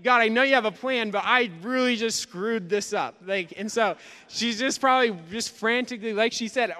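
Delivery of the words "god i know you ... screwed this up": mock panic. Mary's imagined prayer, feeling lost.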